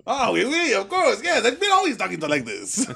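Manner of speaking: playful fake French, teasing the guys; mock French accent. Joking to friends in a put-on French accent. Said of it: "start going into a French accent".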